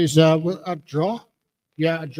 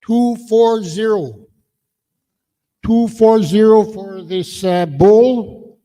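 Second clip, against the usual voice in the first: loud drawn-out call; 4 semitones higher, 6 dB louder, slower than usual. Real, not acted. Host calling the ticket number to the hall, loud and drawn out.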